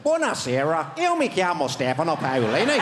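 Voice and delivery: Italian in a broad Northern Irish accent. Shown as a demonstration. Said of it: "Italian, but with a Northern Irish accent".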